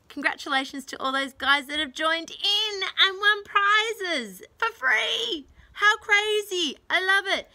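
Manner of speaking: high, loud and gleeful; excited. Real, not acted. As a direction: squeal with delight at the winners. Excited thanks to winners.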